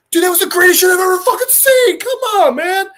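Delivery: loud, high, fast raving; over-the-top excited outburst; ecstatic gushing. Acted. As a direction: blurt it out, thrilled and high-pitched. Peacemaker raves about the gorilla's throw.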